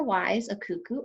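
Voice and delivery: ordinary talk, nothing marked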